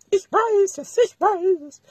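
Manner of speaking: high, put-on little voice; eager quiz answerer. Pretend viewer answers the host's alphabet question, high eager voice. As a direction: pipe up as if you know the answer.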